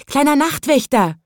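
put-on balloon girl calling up to the watchman. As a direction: call up to him like a little girl. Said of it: "rief es".

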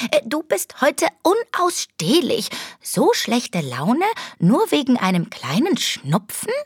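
high, squeaky little rat voice. A narrator's reading. Rat assistant chiding the sulky agent, high squeak. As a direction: pitch way up into a tiny rat.

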